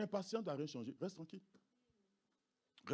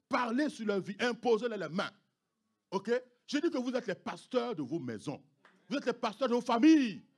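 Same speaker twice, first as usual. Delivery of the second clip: normal talking voice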